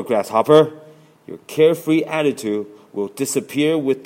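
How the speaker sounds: stern and fed up; frustrated warning. A narrator's reading. Ant warns Grasshopper with growing frustration. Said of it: "as he grows frustrated".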